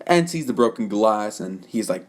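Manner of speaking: ordinary delivery, nothing marked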